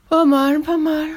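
high words stretched through a yawn. A narrator's reading. Pierre judging the witches' insults, yawning. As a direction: talk while yawning. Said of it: "dit Pierre en bâillant".

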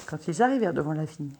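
ordinary delivery, nothing marked

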